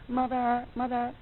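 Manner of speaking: put-on high, soft little-kid voice calling mother; a kid sweetly calling his mom, high and soft.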